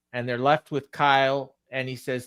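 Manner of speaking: ordinary delivery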